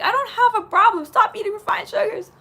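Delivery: high, loud acted exasperation; mock indignant retort. Streamer acting out arguing with friends about sugar, indignant. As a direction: fire back loudly, in mock outrage.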